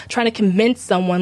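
normal talking voice

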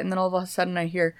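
ordinary talk, nothing marked

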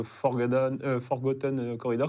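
English words in a French accent. Real, not acted. Hacker reading out his menu to the host, French-accented English. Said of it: "On adore ton accent anglais".

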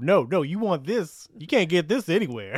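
mock Creole accent. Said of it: "They have this terrible Creole accent".

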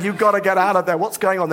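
high, frantic worried mother; alarmed mum. Retold or imitated. Mum on the phone hearing of civil war, high frantic alarm. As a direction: squeal it out like a panicked parent. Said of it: "she said".

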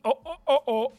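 high, loud mock gasp of alarm; a burst of pretend shock. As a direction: yelp as if caught out. Mock alarm at dipping right.

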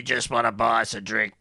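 parched, pleading voice for a drink; thirsty voice. Acted. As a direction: sound dry and thirsty. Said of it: "that's my thirsty voice".

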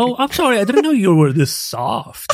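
fake apology. Mocking reply to a mean fan.

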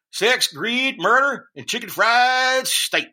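high Texan read, stretch the last words slowly; mock Texan drawl that lingers on chicken fried steak; Texan accent, drawn out. Retake after the cohost asks to elongate the end, slow Texan drawl. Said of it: "You gotta elongate the last bit".

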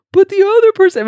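put-on high, loud childish complaint; protesting kid. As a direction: whine it like a child telling on someone. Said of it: "when I was a kid all the time".

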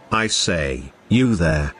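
low, theatrical Shakespearean call across the street. Acted. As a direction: hail a stranger like a stage actor. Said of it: "Summoning up his best Shakespearean voice".

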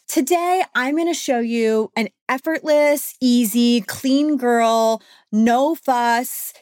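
high, hyped influencer intro. Retold or imitated. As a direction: imitate a perky beauty influencer. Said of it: "she was like".

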